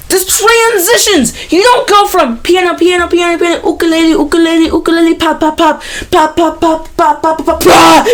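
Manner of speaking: high, sing-song listing of instruments; mock listing. Real, not acted. Reviewer mocking the abrupt instrument switches, high sing-song.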